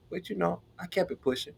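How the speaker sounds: normal talking voice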